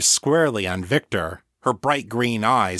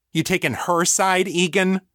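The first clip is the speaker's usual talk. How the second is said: higher-pitched angry challenge; spat out angrily; 8 semitones higher than usual. A narrator's reading. Victor to his friend who took her side, spat out angrily. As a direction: spit it out as an accusation. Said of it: "Victor spat".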